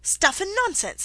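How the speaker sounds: high, loud, indignant outburst; loud protest. A narrator's reading. Alice dismissing the Queen's nonsense, loud and indignant. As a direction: protest loudly, pitched well up. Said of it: "said Alice loudly".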